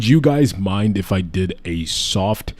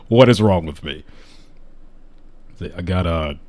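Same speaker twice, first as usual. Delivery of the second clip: normal talking voice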